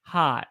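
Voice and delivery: "Hot" with the vowel held long; Southern drawn-out "Hot". Shown as a demonstration. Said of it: "We hold it".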